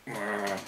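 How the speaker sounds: imitated growl, low and quiet. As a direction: give a soft, low "grr" like the attacker. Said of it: "made a growling sound".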